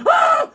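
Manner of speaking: sharp high-pitched yelp; high yelp of panic. Retold or imitated. Boy's leg plunges through river ice, shrill yelp. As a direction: yelp like you just broke through the ice. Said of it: "And I was like".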